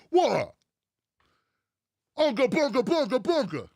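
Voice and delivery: lightly put-on blustering boss voice; mock old-school politician. Old-school boss to complaining staff, mock-baffled bluster. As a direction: protest with clueless, indignant surprise. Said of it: "That's exactly how he sounds".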